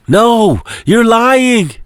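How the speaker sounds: high, tearful denial; disbelieving cry. Acted. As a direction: refuse to believe it, near tears. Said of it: "Viera starts crying".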